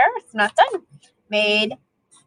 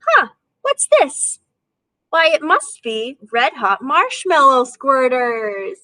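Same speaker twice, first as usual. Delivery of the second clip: a surprised shopkeeper working it out slowly; puzzled grocer; 2 semitones higher, slower than usual. Acted. Grocer puzzles over the picture.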